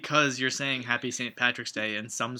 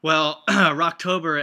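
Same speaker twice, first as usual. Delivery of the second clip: slow, loud, drawn-out Rocktober; grand announcement; 3 semitones higher, 6 dB louder, slower than usual. Acted. Cohost formally introduces Rocktober to listeners, slow and loud.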